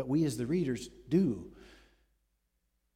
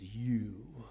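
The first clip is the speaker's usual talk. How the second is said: hushed accusation; 3 semitones lower, 3 dB quieter than usual. Acted. Nathan's accusation, whispered.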